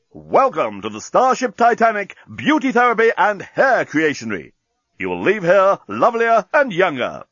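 high, declamatory slogan reading; grand promotional announcer. Acted. Salon motto over the doorway, grand announcer flourish. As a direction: proclaim the motto with showy lift.

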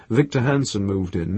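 usual speaking voice

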